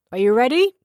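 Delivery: loud, high booming call across the garden. A narrator's reading. Father calling his son from across the garden, booming. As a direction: boom it out to the far end of the yard. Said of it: "his father's voice boomed across the garden".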